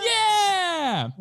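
high drawn-out crowd yeah; crowd cheer. Retold or imitated. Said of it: "the crowd's like".

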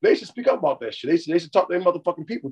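ordinary talk, nothing marked

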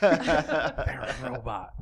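laughing. Real, not acted. Robot joke, laughing.

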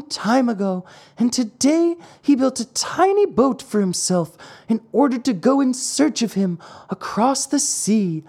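put-on high, worried old woman's voice; little old woman. A narrator's reading. Old woman telling Pinocchio about the lost father, anxious high voice. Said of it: "asked Pinocchio of a little old woman".

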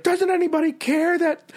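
high mock wail of a drunk woman; hammed-up drunk girl wailing. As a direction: whine it way up high. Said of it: "she's like".

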